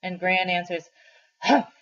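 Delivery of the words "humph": loud, high scornful humph; grandmother's disgusted grunt. Acted. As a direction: snort of disgust at the boy. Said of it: "like she is disgusted".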